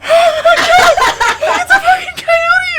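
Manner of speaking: very high, loud, drawn-out panicked scream, laughing through it; hammed-up squeal of terror; mock scared shriek. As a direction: shriek like terrified kids, hammed up. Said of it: "they're, like, scared".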